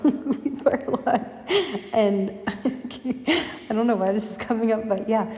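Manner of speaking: giggling through the words; laughing. Real, not acted. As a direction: laugh as you confess it. Admitting her family farts a lot.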